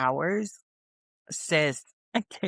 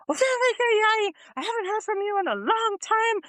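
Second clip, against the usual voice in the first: much higher, mock offended mother voice; stern mom. Mom to her daughter after three months, high mock offended. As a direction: play the hurt mom, pitched way up. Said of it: "She was like".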